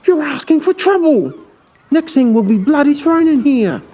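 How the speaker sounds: anxious hiss. Acted. Basil scolding in hushed panic.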